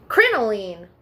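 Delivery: plain reading voice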